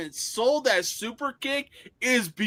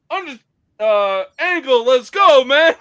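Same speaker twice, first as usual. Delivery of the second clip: loud, higher mock rallying call; Undertaker impression; 5 semitones higher, 10 dB louder than usual. Retelling Undertaker in shades and bandana, loud mock call. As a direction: call out to Angle like a biker pal. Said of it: "Undertaker was like".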